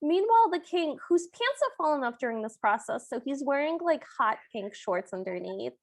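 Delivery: ordinary talk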